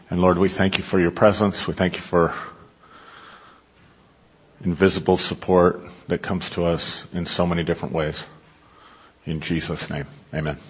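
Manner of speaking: soft, reverent prayer. Acted. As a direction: pray quietly and reverently. Closing prayer.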